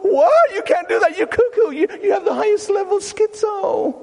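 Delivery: very high, hammed-up shriek of alarm; shocked outburst. Psychiatrist told he quit his medication, shocked high shouting. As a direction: cry out in exaggerated disbelief.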